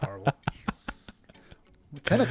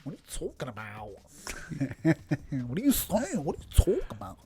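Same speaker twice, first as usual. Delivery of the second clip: imitating [name]; 7 dB quieter than usual. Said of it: "what [name] used to sound like".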